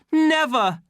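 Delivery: very high, loud refusal; defiant cry. A narrator's reading. Savage refusing the official, crying out. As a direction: cut him off with a cry. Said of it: "cried the savage".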